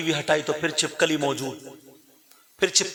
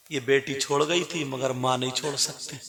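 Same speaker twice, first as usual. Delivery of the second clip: normal talking voice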